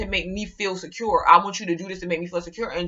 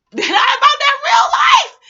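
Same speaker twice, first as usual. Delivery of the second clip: high, loud mocking holler; mocking shout; 8 dB louder than usual. Real, not acted. Calling out people who claim they're real, high mocking shout. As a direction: call them out in a high-pitched yell.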